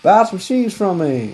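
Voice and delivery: high, mock yell after him; retold yell. Clerk yelling after the customer leaving the store. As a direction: call a customer back, half-hearted. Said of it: "he's yelling at me".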